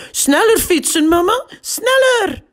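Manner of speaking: put-on high little girl's voice, urgent and loud; Anna calling out. A narrator's reading. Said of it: "roept Anna".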